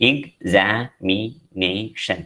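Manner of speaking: examination broken slowly into five separate syllables. Shown as a demonstration. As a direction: say examination very slowly, a pause after each syllable. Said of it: "Five syllables are there".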